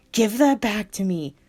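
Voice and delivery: tearful shout; yelling demand. Acted. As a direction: demand it back through tears. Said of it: "I yelled and demanded".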